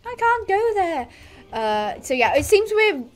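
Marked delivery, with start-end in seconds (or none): 0.0-1.1 high-pitched horse refusing to go; much higher mock plea; reluctant talking horse. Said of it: "come up as a bubble and be like".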